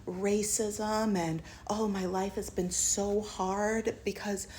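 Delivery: slow, self-pitying, sinks into a whisper; mock sob story. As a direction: hams up his hard-luck tale, mostly whispered. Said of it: "he starts talking about".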